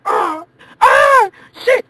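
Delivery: high shouted screams of panic; panicked cry. Acted. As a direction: scream as the plane drops. Plane going down.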